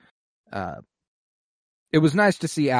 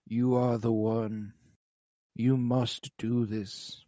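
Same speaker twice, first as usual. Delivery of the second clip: dying king; 4 dB quieter, slower than usual. Retold or imitated. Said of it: "he says".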